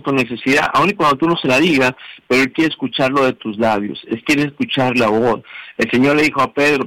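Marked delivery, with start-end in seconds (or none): none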